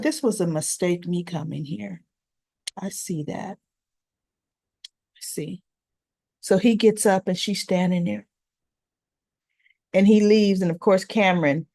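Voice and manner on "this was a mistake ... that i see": fading quiet. Retold or imitated. Daniel leaving Sharon's place, fading quiet. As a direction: lower and much softer, fading away.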